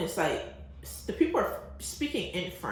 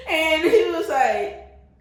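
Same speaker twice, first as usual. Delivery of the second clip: laughing; 11 semitones higher, 8 dB louder, much slower than usual. Real, not acted. Still laughing while retelling.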